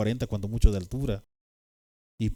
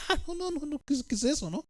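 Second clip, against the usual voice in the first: much higher, softer terrified pleading; panicked crying; 6 dB quieter than usual. Retold or imitated. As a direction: imitate a scared little boy breaking down. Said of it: "yo empecé a llorar desesperado".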